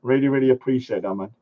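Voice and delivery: ordinary talk, nothing marked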